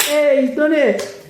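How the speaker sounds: high, slow triumphant cheer; excited cheer. Retold or imitated. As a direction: cheer him on from the window. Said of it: "they're like".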